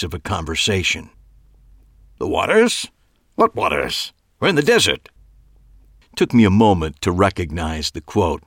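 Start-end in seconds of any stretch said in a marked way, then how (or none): 2.2-5.1 shifted voice, the other side of the dialogue; second voice. A narrator's reading. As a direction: switch to a different character's voice. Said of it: "His voice shifted as if he were doing both sides".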